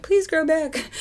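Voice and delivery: high, squeaky mock plea; pleading. Over-tweezed brows, begging them to grow back in a high plea. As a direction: beg your eyebrows like a pet. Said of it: "so I'm like".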